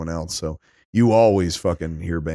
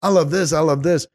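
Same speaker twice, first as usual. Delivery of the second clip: excited; 8 semitones higher than usual. Retold or imitated. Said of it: "I was watching the movie going".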